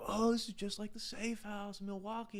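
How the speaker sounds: high-pitched mimicked enthusiasm; imitating gushing customers. People comparing the bar to Safe House, high mimicking gush. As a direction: mimic impressed visitors, voice lifted high. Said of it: "people would always be like".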